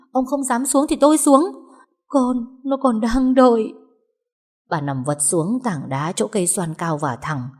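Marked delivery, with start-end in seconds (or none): 0.1-3.7 defiant outburst. Acted. Wife defies husband, crying.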